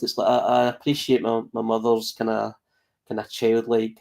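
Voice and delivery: ordinary talk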